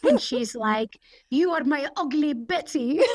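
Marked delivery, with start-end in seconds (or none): 1.3-3.0 Salma Hayek. Retold or imitated. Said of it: "Sorry for that accent".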